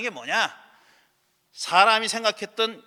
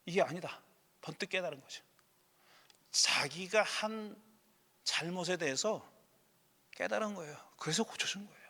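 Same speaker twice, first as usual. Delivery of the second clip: softer, fading down to a whisper; hushed realization; 11 dB quieter than usual. Real, not acted. Explaining Jesus's sudden realization, softly to a whisper. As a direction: let it sink to a breathless hush.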